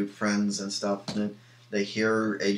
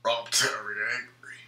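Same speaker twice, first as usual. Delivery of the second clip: caveman hulk speak; 2 semitones higher than usual. Retold or imitated. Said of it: "I can't do it in a British voice".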